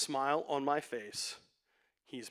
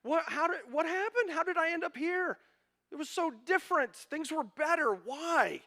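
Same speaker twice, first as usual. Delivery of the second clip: put-on high, fast, whining young voice; whiny lament voice; 13 semitones higher than usual. Pastor voicing the sad psalmist, high and whining. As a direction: play a lost soul whining about the good old days. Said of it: "He's looking back".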